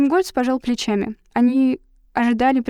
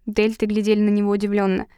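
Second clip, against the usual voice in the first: plain reading voice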